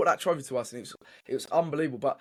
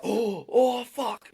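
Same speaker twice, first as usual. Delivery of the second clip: shocked groan; 9 semitones higher, much slower than usual. Retold or imitated. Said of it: "he was like".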